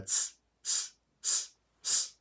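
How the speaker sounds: short unvoiced tss pulses of breath; pulsed hiss. Shown as a demonstration. Voice teacher showing students a pulsed exhale, short hisses. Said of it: "we're going to pulse it out".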